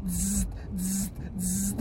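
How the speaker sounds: three short buzzes; imitated game buzzer. Joking about surgery like the Operation game, buzzer imitation. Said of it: "of Operation".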